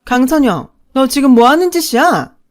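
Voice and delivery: sudden yell. A narrator's reading. Mother catching her daughter packing, sudden furious yell. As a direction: bark the child's full name in fury. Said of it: "엄마가 버럭 소리를 질렀어요".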